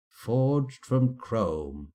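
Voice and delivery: slow, loud, grand declaration; dramatic slow emphasis. Acted. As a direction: drag out each word.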